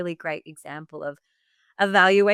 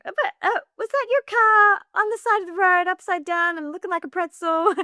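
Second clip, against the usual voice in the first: much higher, disbelieving squeak of a question; stunned high-pitched disbelief, mildly louder; incredulous; 5 dB louder than usual. Retold or imitated. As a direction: ask it as if she must be joking. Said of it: "I'm like".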